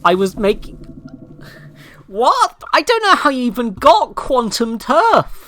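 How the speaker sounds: high, loud, incredulous denial; loud indignant protest. Real, not acted. Streamer denying misheard words, loud and indignant. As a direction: protest loudly, voice jumping up.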